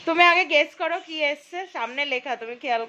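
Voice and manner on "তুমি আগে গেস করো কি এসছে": excited teasing. Real, not acted. Teasing mom to guess gift.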